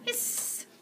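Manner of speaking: unvoiced hiss; cat hiss. The cats hissing at the pair.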